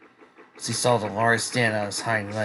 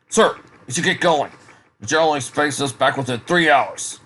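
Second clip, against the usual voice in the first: a touch louder and higher, urging a superior; lightly voiced soldier; 5 semitones higher, 6 dB louder than usual. Soldier reminding his superior to get going, lightly urgent. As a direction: lightly play an insistent soldier.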